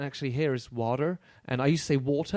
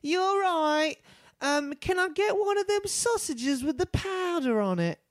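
much higher, slow mock London voice; mocking British accent; 14 semitones higher, slower than usual. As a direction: imitate a clueless British lady ordering. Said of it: "This woman".

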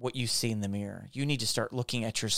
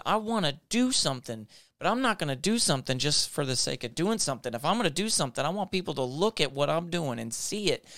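louder and higher, rising sermon fervor; preaching at full force; 4 semitones higher, 4 dB louder than usual. Acted. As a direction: build it up like a revival pulpit.

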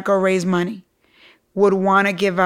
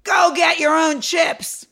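stern boss; 7 semitones higher, slower than usual. Retold or imitated. Quoting boss's rebuke again.

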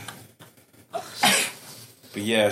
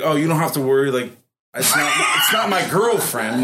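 reassuring; 4 semitones higher, 5 dB louder than usual. Retold or imitated. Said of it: "I was like".

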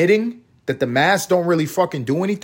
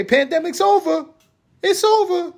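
much higher, mocking falsetto sing-song; sarcastic mock voice. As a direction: ridicule the claim in a silly high voice. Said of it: "I'm being fucking sarcastic".

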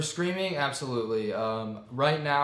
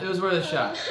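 laughing; 7 semitones higher, 4 dB louder, faster than usual. Real, not acted. Laughing it off after refusal.

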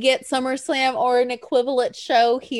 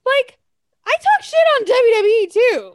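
exasperated retort; 11 semitones higher, 8 dB louder than usual. Retold or imitated. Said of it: "And I'm like".

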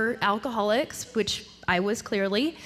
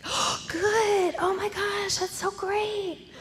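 high-pitched, gushing mom delight; overjoyed mother, high and stretched out; so happy; 11 semitones higher, slower than usual. Retold or imitated. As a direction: gush it way up high like a thrilled mom. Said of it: "like, so happy".

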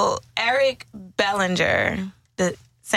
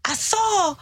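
man voice; 10 semitones higher, slower than usual. Retold or imitated. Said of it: "This is how he was talking".

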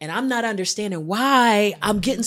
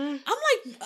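ordinary talk

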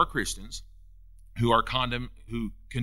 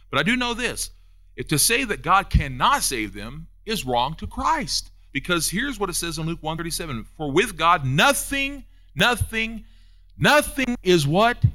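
high, loud scripture proclamation building on each repeat; emphatic preaching; 6 semitones higher, 5 dB louder than usual. Real, not acted. Preacher quoting Luke to congregation that nothing is impossible, emphatic preaching. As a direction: proclaim it forcefully, pitch climbing.

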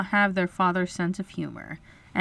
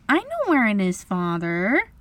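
put-on eager girl's cry; 3 semitones higher than usual. A narrator's reading. Wendy offers to help her father, eager cry. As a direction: pipe up eagerly. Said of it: "Wendy cried".

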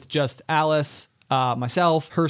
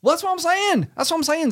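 lightly put-on Southern accent, pitched higher; 13 semitones higher than usual. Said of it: "my voice will be like this".

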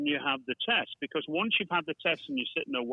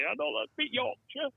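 much higher-pitched mimicked Yorkshire lilt; imitated Yorkshire accent; 10 semitones higher, 3 dB quieter than usual. Father imitating his daughter's speech, high Yorkshire accent. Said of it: "my daughter speak in a Yorkshire kind of dialect".

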